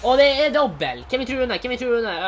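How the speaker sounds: high, loud hype; excited announcement. Real, not acted. Gamer hyping up a double walkout for viewers. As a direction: announce it like a big reveal.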